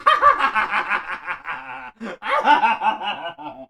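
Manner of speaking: loud, high hammed-up witchy cackle; mock evil cackle. Hair buyer grabbing rusty scissors, with a hammed-up evil cackle. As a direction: cackle like a villain reaching for scissors.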